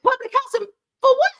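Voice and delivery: high, loud disbelieving question; incredulous. Retold or imitated. Told to go to public housing, asks God in loud, high disbelief. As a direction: ask it in sheer disbelief.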